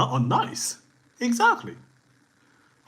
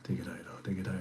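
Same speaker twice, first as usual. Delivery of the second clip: whispered aside; 7 semitones lower, 9 dB quieter, faster than usual. Real, not acted. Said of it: "whispered aside".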